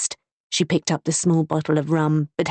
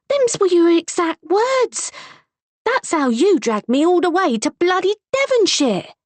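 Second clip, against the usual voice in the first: angry confrontation; 13 semitones higher, 4 dB louder than usual. A narrator's reading. Wife angrily confronting husband.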